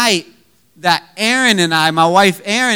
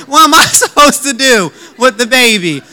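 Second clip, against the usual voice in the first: high, loud, helpless protest; mock panic; 5 semitones higher, 5 dB louder than usual. New dad left holding the baby, mock panic. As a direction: play up the new dad's alarm.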